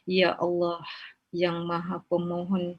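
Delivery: measured chanted supplication with long pleading pauses; leading a prayer. Acted.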